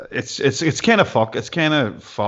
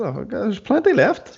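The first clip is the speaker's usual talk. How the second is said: high pleading; 11 semitones higher than usual. Acted. Pleading with Lindsay over wine.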